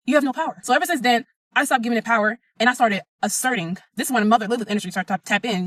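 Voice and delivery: ordinary talk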